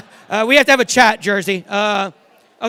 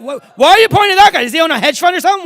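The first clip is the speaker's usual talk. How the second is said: incredulous shout; 5 semitones higher, 9 dB louder, faster than usual. Real, not acted. Disbelief at audience member pointing.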